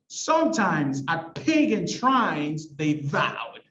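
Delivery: slow, dramatic verse with "Sometimes", "pagan", "shrines" and "vowed" punched; exaggerated stresses. Shown as a demonstration. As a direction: hit each beat hard on "Sometimes", "pagan", "shrines", "vowed". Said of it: "I'm exaggerating a little bit when I read this".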